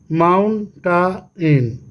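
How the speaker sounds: slow "mountain" as mount, टा, इन; syllable by syllable. Shown as a demonstration. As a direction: spell out three beats, mount, टा, इन. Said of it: "ये three syllable हो गया". ("Mountain" को mount, टा, इन में तोड़कर)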